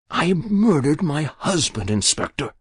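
put-on a guilty old woman pleading with the inspector; Muriel's voice. Muriel confesses.

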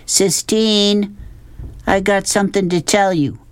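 high, carrying narrated call. A narrator's reading. Rob calling to Sistine to stop, high call. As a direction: call ahead to someone, pitched high. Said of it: "he shouted".

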